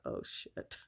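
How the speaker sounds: whispered under the breath; retold inner thought, barely voiced; hushed dismayed aside. Retelling the thought on overhearing dad, whispered dismay.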